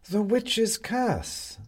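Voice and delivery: high, very slow cat voice; a knowing cat, high and slow; sly cat. Acted. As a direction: draw it out like a smug cat. Cat character speaks.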